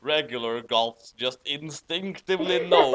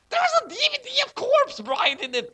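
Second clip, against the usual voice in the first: 1 hammed-up delight; 5 dB louder than usual. Character excited over present.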